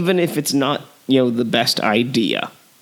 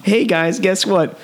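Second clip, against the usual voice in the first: lightly voiced teasing prankster, higher; a hint of a gleeful trickster; prankster director voice; 6 semitones higher than usual. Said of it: "He just wanted to play a joke on everyone".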